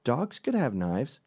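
mocking friend piling on sarcastically; mock agreement. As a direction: fake agreeing squeal. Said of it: "he goes".

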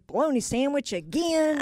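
hammed-up disappointed worker; joke character. Second worker opening the same lunch again, mock dismay.

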